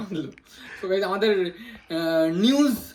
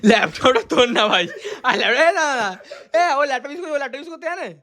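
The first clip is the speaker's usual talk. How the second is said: laughing; 4 semitones higher, 6 dB louder than usual. Real, not acted. Mocking friend's laptop claim.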